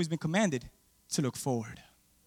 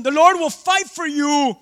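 loud, high, slow scripture reading; scripture read with force; 8 semitones higher, 10 dB louder, slower than usual. Acted. Pastor reading verse fourteen aloud, loud and slow. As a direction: proclaim the verse slowly.

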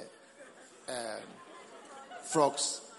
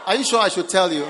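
loud laughing tease, louder than the lines round it; 4 semitones higher, 9 dB louder, faster than usual. Real, not acted. Preacher teasing the congregation with a laughing shout. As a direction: shout the question through a laugh.

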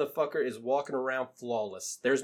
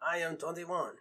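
put-on slow, heavy Russian accent; 4 semitones higher, 5 dB quieter, slower than usual. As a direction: play a Russian guy. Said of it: "That's a great Russian accent".

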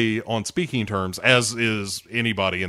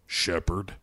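Rex impression; 5 semitones lower, 7 dB quieter than usual. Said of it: "I just started doing, uh, what's his name, Rex from Mass Effect two".

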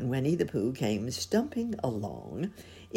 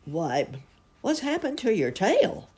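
high, loud astonishment; astonished question rising high; surprised; 9 semitones higher, 4 dB louder than usual. A narrator's reading. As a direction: ask it startled, pitch leaping up. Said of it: "he said in surprise".

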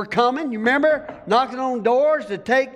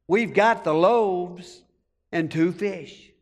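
lower, slow, doubting the loaves; disbelieving inner thought; 5 semitones lower, slower than usual. Retold or imitated. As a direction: say it to yourself, unsure it's enough. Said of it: "thinking to themselves the whole time".

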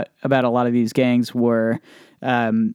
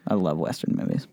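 lower, soft repeat to himself; quiet; 6 semitones lower, 7 dB quieter than usual. Real, not acted. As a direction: murmur it again, fondly. Repeats it softly.

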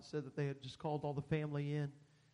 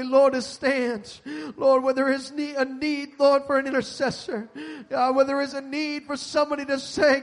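loud, high pleading prayer; fervent prayer; 9 semitones higher, 15 dB louder than usual. Real, not acted. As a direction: cry out to God with urgency.

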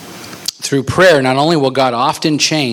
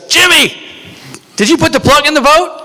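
much higher, louder panicked shout; alarmed mock yell; 6 dB louder than usual. Boater shouts to his friend about the plug, alarmed yell. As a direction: act out a frantic call across to a buddy.

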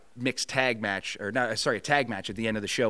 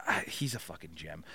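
whispered aside; 5 semitones lower, 9 dB quieter than usual. Real, not acted. Fond aside about colleague.

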